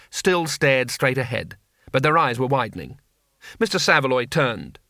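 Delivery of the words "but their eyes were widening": ordinary delivery, nothing marked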